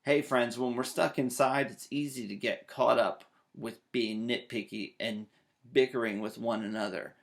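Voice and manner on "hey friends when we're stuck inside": plain reading voice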